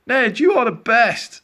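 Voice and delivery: loud, high cheer; shouting up. A narrator's reading. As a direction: shout it up to the sky like a crowd of children. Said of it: "they shouted up".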